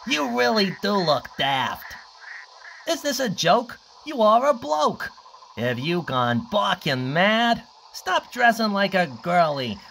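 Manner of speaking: high, loud jeering taunt; mock him like a mean bully. Hyenas taunting Cliff about his dad, loud mockery. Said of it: "they mocked".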